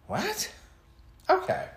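high-pitched disbelieving what; incredulous. Retold or imitated. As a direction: let it leap way up in disbelief. Acts out baffled reply to acquaintance.

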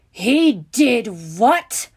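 loud, drawn-out bellow; furious, stretched-out shout, rising at the end; outraged roar. A narrator's reading. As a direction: roar each word slowly in fury. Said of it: "Severus roared".

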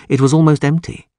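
usual speaking voice

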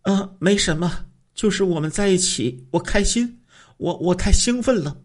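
high woman's voice faking happiness; the wife hides her fear from her lover with forced cheer; feigned cheer. A narrator's reading. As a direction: cover the nerves with forced brightness. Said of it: "假装的回答说".

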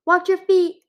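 high, loud warning call. A narrator's reading. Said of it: "Deathbringer called".

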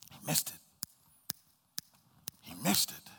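slow breathy whisper; whispered lament. Acted. Preacher lamenting to congregation, whispered slowly. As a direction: let it fall to a whisper. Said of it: "repeated lament".

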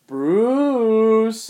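high, loud call into the house. Retold or imitated. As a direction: shout his name into the dark. Goon calls into dark manor.

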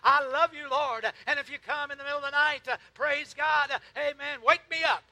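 rising, loud pulpit fervor; full-force preaching. Real, not acted.

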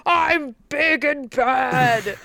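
slow, puffed-up mock villain, a little theatrical; mock villain boast. Mocking the villain's big entrance, slow and puffed-up. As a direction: drawl it out like a cartoon bad guy.

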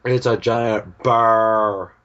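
loud, slowly stretched reveal; slow, booming show-and-tell reveal; drawn-out announcement. Acted. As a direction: announce it big, dragging out the words. Reveals the giant bear on request.

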